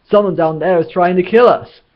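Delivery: loud, high-pitched excitement; thrilled outburst. A narrator's reading. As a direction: burst out with delighted amazement. Zaphod thrilled at being attacked.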